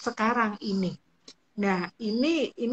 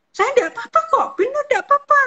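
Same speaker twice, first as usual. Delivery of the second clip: much higher and louder, almost shouting "nggak apa-apa"; 9 dB louder, faster than usual. Shown as a demonstration. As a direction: blurt it out high and loud, tense. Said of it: "Kalau dengan nada yang misal". (nada tinggi dan keras)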